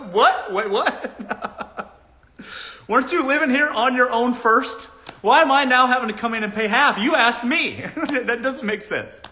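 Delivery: mock disbelief. Woman's incredulous reply, laughing.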